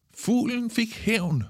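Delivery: high, drawn-out victory call; calling after him. A narrator's reading. Pip crowing after soaked Kalle, high drawn-out call. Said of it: "råber Pip efter ham".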